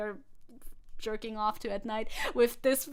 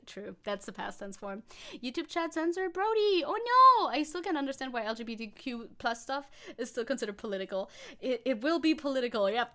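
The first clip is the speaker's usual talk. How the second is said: ordinary delivery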